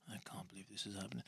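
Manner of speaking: quick whisper under the breath; very soft, hurried aside. Pretend. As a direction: whisper to yourself in disbelief. Muttered despairing aside to self.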